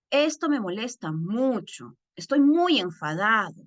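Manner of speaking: firm, emphatic displeasure. Shown as a demonstration. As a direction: lift the voice and stress the feeling without yelling. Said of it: "Estoy alzando la voz, no estoy gritando".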